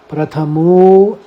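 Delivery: प्रथमो slowed, final ओ held long; प्रथमो with a long drawn ओ; avagraha stretch. Shown as a demonstration. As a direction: pull out the ओ of प्रथमो. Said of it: "प्रथमो को ज़्यादा खींचेंगे".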